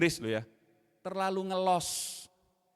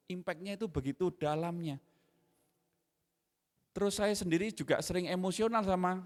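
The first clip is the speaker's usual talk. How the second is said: usual speaking voice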